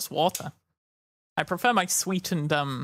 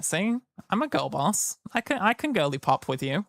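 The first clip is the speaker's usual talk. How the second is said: ordinary talk, nothing marked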